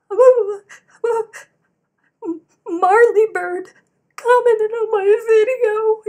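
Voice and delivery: high, loud, overwhelmed wail; mock bawling. Fangirl telling Catherine about the comment, mock bawling. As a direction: re-enact blubbering out big news in slow bursts. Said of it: "by this time I was bawling".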